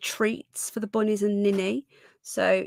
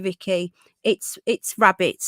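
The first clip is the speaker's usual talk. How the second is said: ordinary talk, nothing marked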